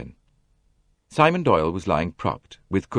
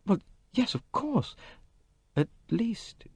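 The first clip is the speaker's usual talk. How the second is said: put-on young woman, unsure; 6 dB quieter than usual. A narrator's reading. Jackie hesitating, high voice.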